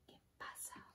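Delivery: whispered; a hushed wondering; breathless whisper. A narrator's reading. Bear feeling the pepper tickle his nose, whispered.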